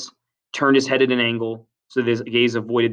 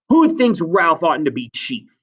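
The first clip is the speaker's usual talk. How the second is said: loud, slow, higher challenge; challenging call; 6 semitones higher, 6 dB louder than usual. Acted. Jack calls for a vote, loud challenge. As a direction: call it out deliberately to a crowd.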